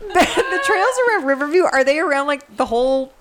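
talking through giggles; laughing. Real, not acted. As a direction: ask it while still laughing. Asks question while laughing.

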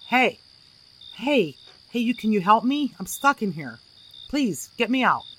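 loud, high urgent calls for help; trapped man calling out. A narrator's reading. Trapped man yells to the boys, loud and high-pitched. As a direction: shout through the shimmer to be heard. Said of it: "a man's voice came through the shimmer".

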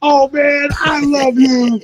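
high, drawn-out fan shout; imitated gushing cop. Cop receiving signed pictures, imitated adoration. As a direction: gush over the idol, slowly stretched.

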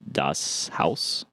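"Das Haus" said dahss HOWSS, low and rising; German-style pronunciation. Shown as a demonstration. Reader puzzling over a name, "Das Haus" said low as German. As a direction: murmur "Das Haus" as an unsure question. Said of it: "H A U S".

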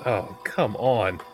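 ordinary delivery

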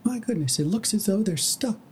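high, gentle pony voice. Acted. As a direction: play a kindly pony. Pony voices rescuing him.

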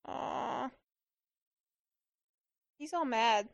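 ordinary delivery

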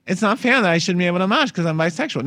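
hammed-up Staten Island whine; Staten Island accent. Host mocking Miss Staten Island's complaint in a Jersey Shore accent. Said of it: "That's how they talk".

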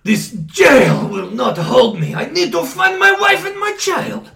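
slow, grand heroic declaration; dramatic vow. Acted. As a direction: declare it like a hero. Prisoner vows escape.

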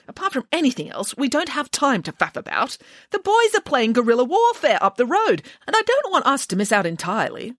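a hint of fussy indignation; impatient nanny. A narrator's reading. Voices impatient nanny in story.

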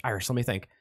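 ordinary talk, nothing marked

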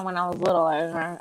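ordinary talk

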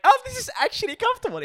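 very high and fast pleased voice; contented baby voice. Retold or imitated. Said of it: "They're like".

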